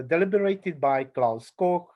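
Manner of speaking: usual speaking voice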